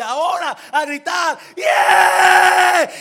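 high, loud shouted battle cry; rally the army with a roar. Retold or imitated. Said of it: "entonces Josué dice". (grito de guerra, alto y fuerte)

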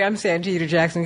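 normal talking voice

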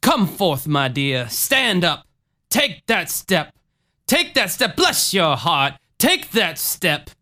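slow, high preacher coaxing; Jim Jones. Acted. Healer urges old lady from her wheelchair, slow theatrical voice. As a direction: draw out each command like a faith healer. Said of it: "Jim said".

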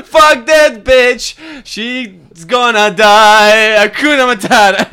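put-on high, slow, loud drunk yelling; drunken shouting. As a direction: yell it like a wasted roommate. Drunk belting mock scene.

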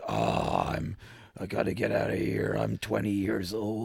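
put-on low, soft shaky old man; lightly voiced old Harrison Ford. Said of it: "His old man voice?".

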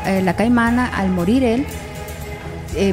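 usual speaking voice

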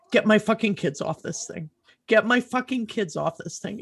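high, urgent relived demand; retold desperation, pitched high without shouting; desperate parent's plea. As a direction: plead for the children, emotion rising.

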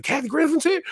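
high-pitched astonished question; excited surprise. Retold or imitated. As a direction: act out a thrilled double take. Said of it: "he was so excited".

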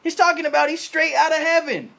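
loud, high mocking townsfolk shout; mocking crowd. Preacher voicing the scoffing crowd, loud and high. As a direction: yell it like a scoffing neighbor. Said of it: "They're like".

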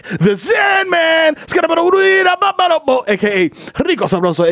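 high, slow shouted intro; hype shout. Acted. Hype man announcing himself, high slow shout.

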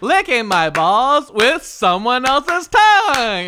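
loud, high, drawn-out singer impression. Player improvising an answer in the game, hollered impression. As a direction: holler it slowly in rhythm. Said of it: "an impersonation of [name]".

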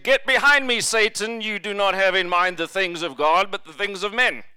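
loud, forceful retold rebuke; mock-gentle rebuke. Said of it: "just gently said".

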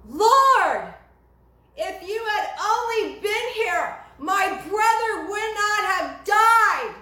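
high, loud, slow pleading cry; anguished plea at his feet. Retold or imitated. Mary at Jesus's feet, grieving brother, high anguished plea. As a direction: throw the words out like a grieving sister begging. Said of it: "yes, says to him".

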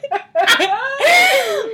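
high shrieking burst of laughter. Real, not acted. Big laugh at joke.

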